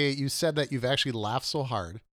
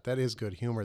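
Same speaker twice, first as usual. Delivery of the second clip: normal talking voice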